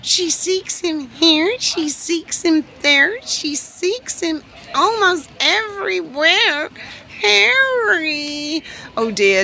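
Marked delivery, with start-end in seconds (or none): 0.0-6.7 high, slow, dramatic recital; theatrical recitation. Pretend. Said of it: "He's the Scarlet Pimpernel". 7.2-8.6 high mock call for Harry. Calling out for missing Harry.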